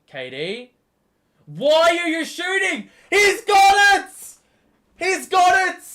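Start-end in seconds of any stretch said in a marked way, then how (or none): 1.5-2.9 high, loud yell at the screen; real shout. Real, not acted. Fan yells at K D for shooting, high loud shout. 3.1-5.8 very high, loud, elated scream; ecstatic scream. Real, not acted. Fan screams as K D hits the shot, ecstatic yell.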